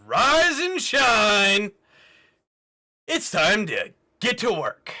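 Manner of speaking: loud, high, drawn-out holler; drill-sergeant wake-up. Acted. Game master as officer waking the sleeping party, shouted wake-up call. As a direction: bellow it slowly as if bursting through the door.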